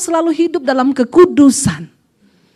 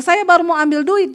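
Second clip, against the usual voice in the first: normal talking voice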